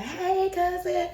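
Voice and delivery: high, slow, sing-song hey; cousins greeting. Retold or imitated. Two same-age cousins greeting each other, high and drawn out. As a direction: stretch the greeting out like a kid. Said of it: "so we like".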